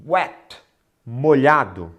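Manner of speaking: ordinary delivery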